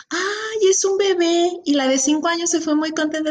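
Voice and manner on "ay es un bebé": gentle explaining. Retold or imitated. Aunt telling her niece about the baby, sweet and high. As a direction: answer sweetly like explaining to a toddler. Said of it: "Y yo le decía".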